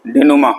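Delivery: डेनुमा said as de-nu-maa, unlike its spelling; pronunciation of डेनुमा. Shown as a demonstration. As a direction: end डेनुमा on an open 'maa'. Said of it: "spelling थोड़ा अलग है". (डेनुमा का उच्चारण, spelling से अलग)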